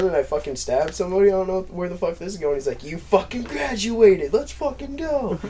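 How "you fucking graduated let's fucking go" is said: high, slow, drawn-out mock cheer; excited recruiter. Recruiter hearing the recruit graduated early, hammed-up high cheer. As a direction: cheer it high and stretched, imitating a thrilled sergeant.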